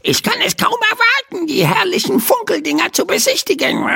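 very high, fast, chirpy bird voice; squealing magpie. A narrator's reading. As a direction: squeal with glee like a tiny magpie. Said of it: "jauchzte sie".

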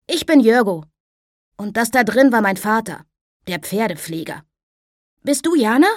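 put-on a lively boy cutting in on his father; bright boy's voice. Boy telling his father who the girl is, high and bright.

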